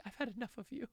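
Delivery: prompted British line. Shown as a demonstration. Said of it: "can you do British or can you do French".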